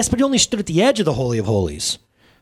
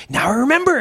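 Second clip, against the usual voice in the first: excited exclamation; 9 semitones higher than usual. Acted. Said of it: "exclaimed the thief".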